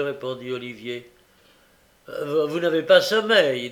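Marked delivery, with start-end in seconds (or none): 2.1-3.7 louder, smug and self-pleased; satisfied. A narrator's reading. Doctor smugly confirming to the boy, satisfied. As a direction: sound pleased with your own diagnosis. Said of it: "dit le docteur d'un air satisfait".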